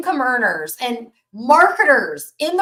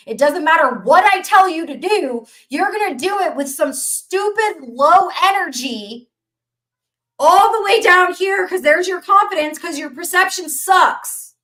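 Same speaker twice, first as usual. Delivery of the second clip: louder and higher, building motivational rant; fired-up preaching; 8 semitones higher, 5 dB louder than usual. Acted. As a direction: hype them up like a preacher.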